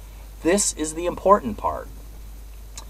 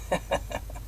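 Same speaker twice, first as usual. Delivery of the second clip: soft laugh; 10 dB quieter than usual. Real, not acted. Laughs after pirate call.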